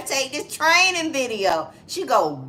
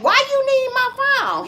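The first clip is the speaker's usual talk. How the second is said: high indignant protest; 4 dB louder than usual. Retold or imitated. Retold protest.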